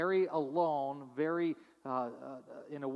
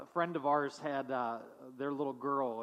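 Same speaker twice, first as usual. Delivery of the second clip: normal talking voice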